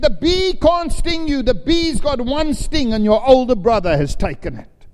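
put-on a dad calming his panicking boy with authority; firm reassuring father. Father reassures frightened son.

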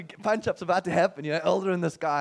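ordinary talk, nothing marked

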